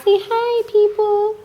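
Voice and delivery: high, sing-song coaxing voice. Real, not acted. Prompting the baby to greet viewers.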